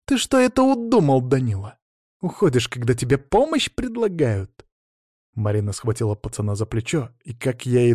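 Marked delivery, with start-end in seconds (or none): 0.0-4.6 high-pitched cries of reproach; menacing scolding. A narrator's reading. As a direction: lay into the boy, voice jumping high. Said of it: "грозные крики".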